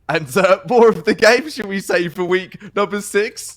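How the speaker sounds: loud, high broadcast hype; announcer delivery. Real, not acted. Caster plugging the league week, hyped announcer delivery. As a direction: sell the show with big projection.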